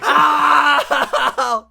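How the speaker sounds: loud scream breaking into laughter; laughing scream. Acted. GM screaming as Helmsworth, then laughing. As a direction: shriek and crack up. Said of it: "all that's coming out of your mouth".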